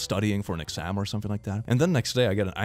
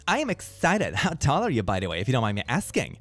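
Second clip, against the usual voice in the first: normal talking voice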